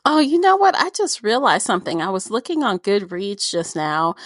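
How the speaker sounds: ordinary talk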